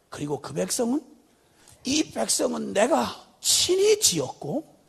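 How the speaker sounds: ordinary delivery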